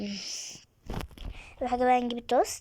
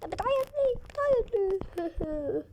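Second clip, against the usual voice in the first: put-on cooing baby talk; 9 semitones higher, slower than usual. Soothing a crying game baby.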